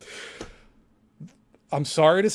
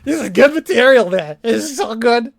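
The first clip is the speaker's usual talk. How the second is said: gloating, higher, louder at the start; mocking imitation of Tom; 8 semitones higher, 5 dB louder than usual. Streamer mocks Tom gloating over the clip, higher and smug. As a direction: crow like a smug man caught out. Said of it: "I'm just imitating him".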